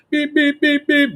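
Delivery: high, even honks; imitated car horn. Driver honks at the man, high imitated beeps. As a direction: beep like a horn being leaned on. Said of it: "just laying on the horn".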